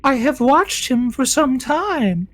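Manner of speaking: high, slow, eerie confession; eerie queen voice. Acted. Fish queen revealing her crystal ball to the party. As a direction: linger over it like a lovesick monster. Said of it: "and she'll go".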